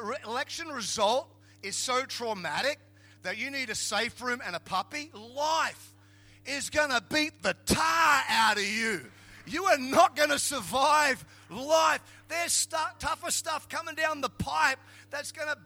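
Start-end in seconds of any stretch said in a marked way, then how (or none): 5.1-14.8 loud, raised, emphatic preacher delivery; hard-hitting shouted warning; forceful preaching warning. Real, not acted. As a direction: drive each word home loudly.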